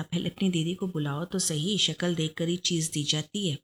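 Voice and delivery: plain reading voice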